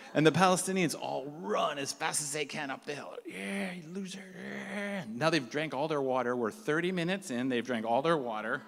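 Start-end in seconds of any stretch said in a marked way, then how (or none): 3.3-5.0 high, soft mock jeer; distant jeering. Palestinians racing past up the hill, jeering. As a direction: imitate far-away runners taunting in a high voice.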